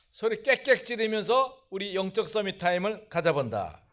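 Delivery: ordinary talk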